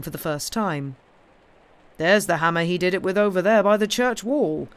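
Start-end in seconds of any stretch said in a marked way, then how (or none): 2.0-4.7 firm, louder, measured statement; sensible correction. A narrator's reading. Inspector correcting the cobbler about the hammer, sensible and firm. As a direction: point it out firmly, less hurried. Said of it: "said the inspector, a sensible-looking man".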